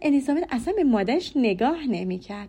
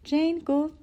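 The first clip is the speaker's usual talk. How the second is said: ordinary delivery, nothing marked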